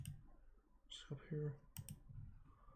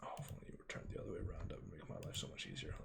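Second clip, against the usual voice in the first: whispering; 7 semitones lower, 11 dB quieter, much faster than usual. Real, not acted. Muttering to self while mapping.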